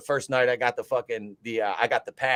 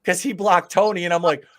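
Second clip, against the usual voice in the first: ordinary delivery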